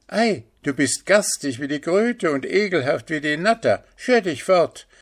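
put-on high, taunting children's voices; mock and chase the boy off; jeering playmates. A narrator's reading. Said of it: "verlachten sie ihn und sagten". (spöttisch johlende Spielgefährten)

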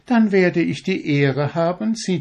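very high, tiny mouse voice; high mouse inviting Friedrich; polite little mouse. A narrator's reading. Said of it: "sagte die kleine Maus".

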